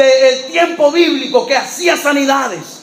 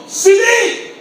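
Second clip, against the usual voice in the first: strong voice of Jesus; 8 semitones higher than usual. Acted. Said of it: "con una voz fuerte".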